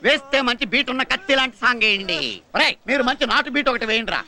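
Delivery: usual speaking voice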